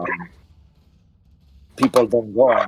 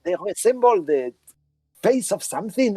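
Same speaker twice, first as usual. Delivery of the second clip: very high, apologetic character voice; Claudia Velasco; 12 semitones higher than usual. Acted. Woman pretends she was cloud-gazing, high feigned innocence. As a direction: play the innocent, pitched way up. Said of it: "And she says".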